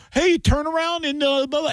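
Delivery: put-on high, bright call of a teacher turning kids around; mock teacher call. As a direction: sing out like a teacher. Teacher voiced in retold story.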